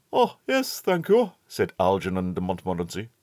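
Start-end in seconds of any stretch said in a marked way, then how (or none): 0.1-1.3 high, polite schoolboy reply. A narrator's reading. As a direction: answer with good manners. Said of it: "with native politeness".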